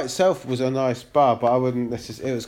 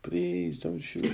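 slow, hushed mock Israeli accent; pleading softly in an Israeli accent; 2 semitones higher, 9 dB quieter, much slower than usual. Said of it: "You do an Israeli accent".

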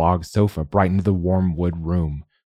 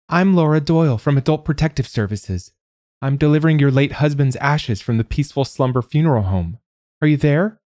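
high nasal official announcing herself; high-pitched social worker voice, questioning at the end; nasal caller; 8 semitones higher than usual. A narrator's reading. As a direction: call it through a door, high and nasal. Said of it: "called a nasal voice".

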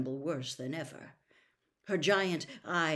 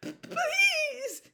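tearful stammering, extremely high and loud; Winky; 14 dB louder than usual. A narrator's reading. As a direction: plead in a piercing squeak, catching on each first sound. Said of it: "Winky stammered".

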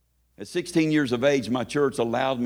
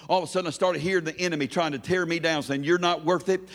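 higher, driving testimony building without a break; full-force preaching; 7 semitones higher than usual. Real, not acted. Preacher urging the congregation to lift up praise, fervent preaching. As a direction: sustain a fervent, pitched-up preaching cadence.

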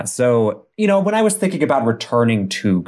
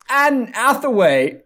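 much higher, slow, faked English accent; bad English accent; 12 semitones higher, slower than usual. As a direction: announce her name, hammed up. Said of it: "Anne Hathaway is actually a very common name over there".

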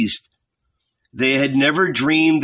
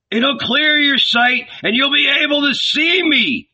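put-on hoarse drunk calling; 11 semitones higher than usual. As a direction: bellow at a friend passing by. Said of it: "a hoarse voice from one of the tents".

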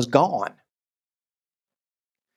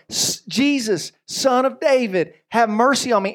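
crying out all the more; 4 semitones higher than usual. Acted. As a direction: stretch each word out, pleading harder. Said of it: "he cried out all the more".

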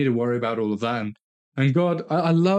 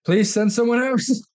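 much higher, pleading voice; whiny Moses; 11 semitones higher, 4 dB louder than usual. Retold or imitated. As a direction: beg to get out of it. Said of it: "But he said".

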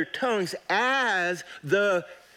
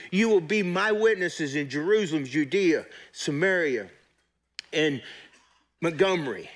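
normal talking voice

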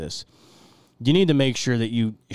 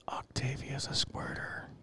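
whispering; 4 semitones higher, 14 dB quieter, slower than usual. Real, not acted. Private whisper into the mic.

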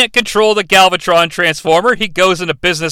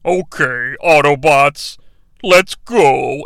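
heartbroken Optimus; 6 semitones lower, much slower than usual. Retold or imitated. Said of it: "he's like".

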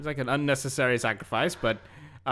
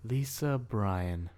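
slow; 3 semitones lower, 6 dB quieter, much slower than usual. Real, not acted. Savoring the name with a sigh.

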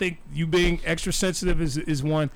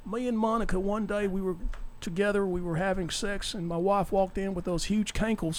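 soft, confiding politician voice; Bill Clinton impression; 4 dB quieter than usual. Host impersonating a president owning up to an affair, soft Clinton voice. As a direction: softly explain like a folksy president. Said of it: "that's my Bill Clinton".

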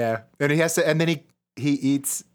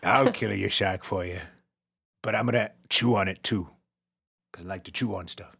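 low dog-man fisherman voice; lightly voiced shark-hunting dog; gruff old fisherman; 7 semitones lower, 4 dB quieter than usual. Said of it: "talented shark hunter dog man".

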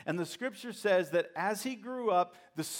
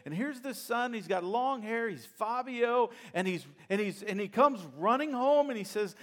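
ordinary delivery

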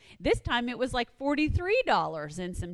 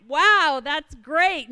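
mock delight; 5 semitones higher, 6 dB louder, much slower than usual. Retelling delighted reaction to offer.

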